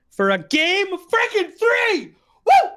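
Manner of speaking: loud, high hype yell with drawn-out words; eardrum-busting shout. Real, not acted. Caster opens game three for the viewers, eardrum-busting excited shout. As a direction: blast it out excitedly, stretching each word. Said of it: "Sorry about your eardrums".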